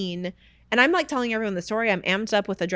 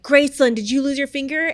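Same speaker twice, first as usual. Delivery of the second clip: upset husband; 4 semitones higher, 6 dB louder than usual. Retold or imitated. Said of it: "He's like".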